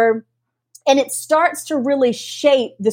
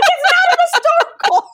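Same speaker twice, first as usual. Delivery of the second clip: high, laughing mock protest; exasperated joking denial, pitched way up; much faster than usual. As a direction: squeal a playful denial, breaking into laughter. Mock protest at friend's teasing.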